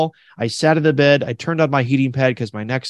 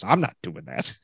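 lower, softer, deadpan no; flat refusal; 8 semitones lower, 8 dB quieter than usual. Retold or imitated. Nurse refusing the microwave trick, low and deadpan. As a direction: shut the idea down in a low mutter.

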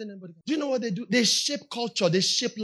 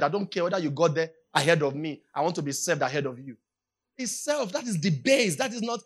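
normal talking voice

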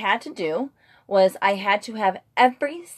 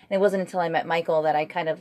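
ordinary talk, nothing marked

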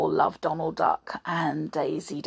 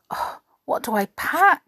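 muttering Donald; 8 semitones higher than usual. Acted. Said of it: "Donald muttered to himself".

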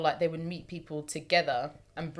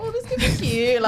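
high, louder, laughing delight; giddy quote; 7 dB louder than usual. Retold or imitated. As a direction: giggle it out, thrilled. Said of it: "and I thought".

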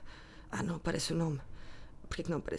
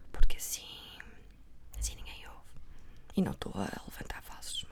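conspiratorial whisper; 10 semitones lower, 4 dB quieter than usual. Real, not acted. Telling listeners the gossip in a secret whisper. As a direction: keep it secret, just breathe the words. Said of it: "mas vou falar baixinho".

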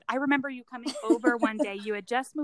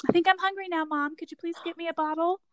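put-on high, dainty little-girl voice; sweet baby; 7 semitones higher than usual. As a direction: imitate a politely hungry baby, pitched high. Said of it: "sweet Remy, she's just like".

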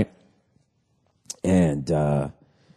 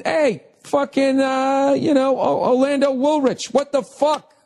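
high-pitched frantic mother in the back seat; panicked mother; 13 semitones higher than usual. Retold or imitated. Mother in the spinning car, shrieking in high panic. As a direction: squeal it like a panicking passenger. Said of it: "the mother, is going".

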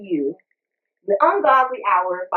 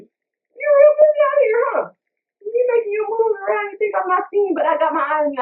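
much higher and louder, accusing yell; jealous girlfriend shouting at her man; mock angry shout; 12 semitones higher, 3 dB louder than usual. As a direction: yell at a cheating boyfriend. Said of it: "old girl getting the same text".

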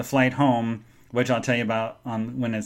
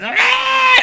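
high, loud mock scream; sudden piercing fake cry; imitated child's wail; 6 dB louder than usual. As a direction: shriek like a bawling toddler. Imitates the crying child again.

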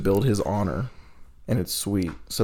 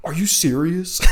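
high, incredulous mock annoyance; mildly indignant imitation; retold annoyed disbelief; 8 semitones higher than usual. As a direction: imitate a fed-up coworker's high-pitched question. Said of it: "just pissed at me".

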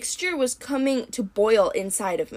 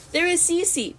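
plain reading voice

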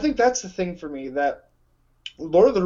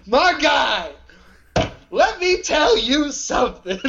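wrestling promo voice; 9 semitones higher, 5 dB louder, slower than usual. Acted. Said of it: "Just start cutting a promo".

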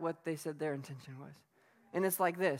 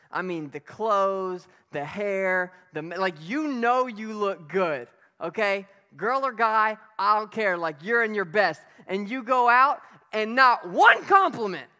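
building excitement; 3 semitones higher, 10 dB louder than usual. Acted. Preacher hyping the crowd about looking good, loud. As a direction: pump it up about the outfit.

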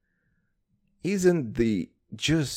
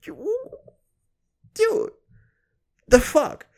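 shocked outburst; much slower than usual. Pretend.

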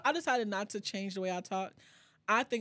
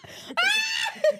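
crying with laughter, high shriek; screaming laugh; 7 dB louder than usual. Real, not acted. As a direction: howl with laughter until it cracks. Howling at own story.

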